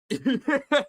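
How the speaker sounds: loud, high real laugh; burst of laughter. Real, not acted. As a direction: crack up loudly. Laughs at a teasing chat message.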